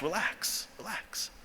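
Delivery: very soft, near-whispered reassurance; hushed calming. Retold or imitated. Commander to the accusing trainee, hushed calming. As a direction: defuse him with a hushed voice.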